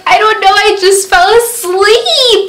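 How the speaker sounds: high-pitched mock wail, dragged out; wailed excuse, imitating herself in tears; retold crying. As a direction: imitate a tearful confession. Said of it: "I was all crying".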